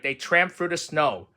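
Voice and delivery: ordinary delivery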